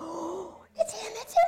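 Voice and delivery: thrilled squeal. Retold or imitated. Retold thrill as Butler calls.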